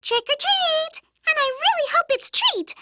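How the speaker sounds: high, eager call; eager high-pitched trick-or-treater; excited trick-or-treating. Acted. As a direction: chirp it high with hopeful excitement.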